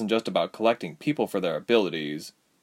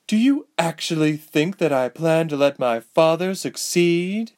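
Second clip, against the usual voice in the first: bitter; 8 semitones higher, 4 dB louder than usual. Acted. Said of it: "Amelia said bitterly".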